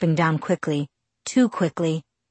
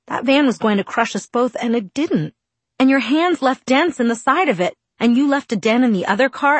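higher, louder, rushing out; angry rush; 8 semitones higher, 5 dB louder than usual. Acted. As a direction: blurt it all out angrily. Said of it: "It came out in a rush".

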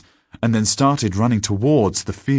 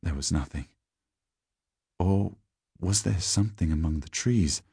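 ordinary delivery, nothing marked

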